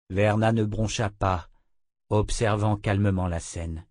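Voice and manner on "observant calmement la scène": usual speaking voice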